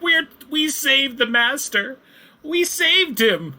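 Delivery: high, slow, squeaky little creature voice; high, slow kobold proudly reporting to the dwarves. Acted. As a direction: pipe it up like an eager kobold.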